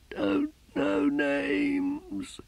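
weak, slow, far-off voice of the dying; a dying heretic's last words; faint dying prisoner. A narrator's reading. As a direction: barely get the words out, fading.

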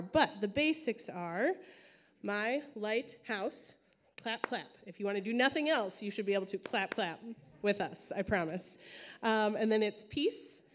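usual speaking voice

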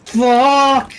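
frustrated shout. Real, not acted. Teammate goes down, frustrated.

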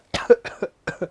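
breathy voiceless cough; demo cough. Shown as a demonstration. Teacher coughing to show 콜록콜록. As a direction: cough once for the class. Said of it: "이거를 콜록콜록이라고 합니다".

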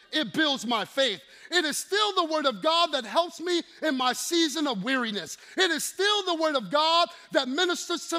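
loud rolling preacher cadence; preaching at full force. Acted. Preacher stirring the congregation, full force. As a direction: build the room up, pounding each line.

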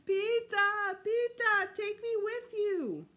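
high, loud, slow bawling plea. A narrator's reading. Fudge clinging to Peter's leg, bawling. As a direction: cry out clinging to his leg. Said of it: "started bawling".

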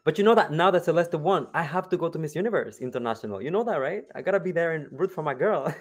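loud, high, giddy excitement; excited. Real, not acted. Excited about going to pageant.

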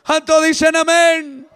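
high, loud sermon at full force; fervent preaching. Real, not acted. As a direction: shout it out to rouse the congregation.

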